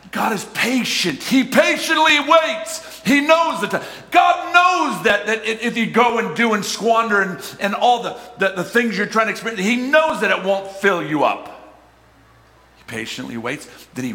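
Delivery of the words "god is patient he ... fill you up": very high, loud, slowed preaching shout; preaching at full force. Acted. Pastor shouting to church about God's patience.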